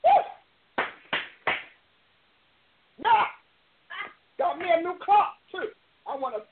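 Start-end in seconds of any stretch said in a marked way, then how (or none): 0.1-0.9 high, sudden woo; excited whoop. Real, not acted. Preacher breaking off from the teaching, excited whoop.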